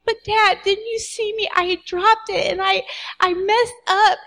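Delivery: lightly voiced child confessing a mistake; upset little girl. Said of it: "I'm like".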